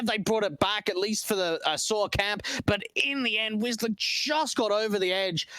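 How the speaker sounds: normal talking voice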